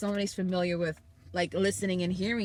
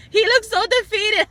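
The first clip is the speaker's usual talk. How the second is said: much higher and louder exclamation; emphatic sympathy; 13 semitones higher, 9 dB louder than usual. Real, not acted. Telling viewers about the boy's fall, emphatic high exclamation. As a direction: cry it out high and loud, really feeling for him. Stressing how beaten the boy looked.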